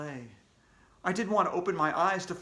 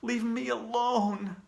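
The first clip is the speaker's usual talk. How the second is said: quivering plea; 7 semitones higher, slower than usual. Acted. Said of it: "my voice quivering".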